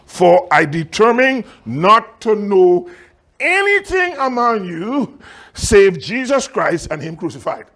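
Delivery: slow, emphatic preacher reading a verse; measured pulpit declamation; scripture reading. Acted. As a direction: proclaim the verse, drawing out key phrases. Reads scripture aloud emphatically.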